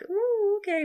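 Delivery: mock-startled little whoop; awkward reaction. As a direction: react as if taken aback, pitch shooting up. Acting reaction to an awkward reveal.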